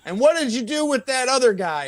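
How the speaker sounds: high-pitched mock accusation; drunk demanding answers. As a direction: imitate a drunk calling someone out. Drunk friend confronting the musician.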